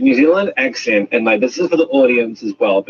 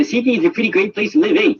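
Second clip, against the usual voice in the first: fast, high, broad Australian; Australian accent; 10 semitones higher, faster than usual. Shown as a demonstration. Demonstrating for the audience, high Australian accent. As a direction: squeeze the vowels toward "ee" in broad Australian. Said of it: "that's Australia".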